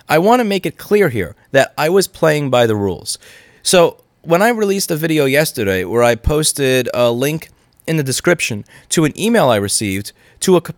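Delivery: normal talking voice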